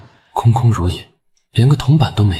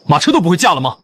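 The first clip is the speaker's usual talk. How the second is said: higher-pitched exasperated rebuke; irritated scolding; 7 semitones higher, faster than usual. Acted. Prince berates the driver for a rough ride, irritated. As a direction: scold a clumsy servant.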